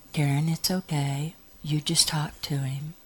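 soft, hushed reassurance; quiet retold comforting; reassuring. Acted reassurance in story.

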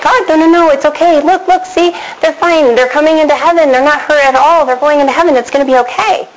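high, rushed reassurance to God; urgent pleading. Retold or imitated. Woman pleading with a grieving God, high and urgent. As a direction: talk God out of his sadness.

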